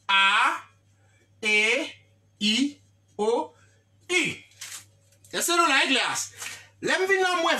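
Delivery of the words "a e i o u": A, E, I, O, U spaced out one by one; teacher calling out the vowels one at a time; reciting vowels. Shown as a demonstration. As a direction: list the five vowels with pauses between them. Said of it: "genyen senk vwayèl yo".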